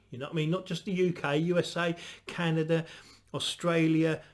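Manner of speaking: normal talking voice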